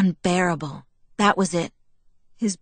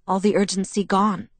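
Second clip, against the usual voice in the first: ordinary delivery, nothing marked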